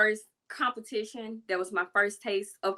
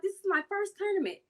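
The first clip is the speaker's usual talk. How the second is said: put-on naive kid voice; 10 semitones higher than usual. Naive younger self.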